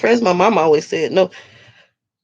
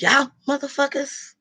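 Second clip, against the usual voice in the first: ordinary talk, nothing marked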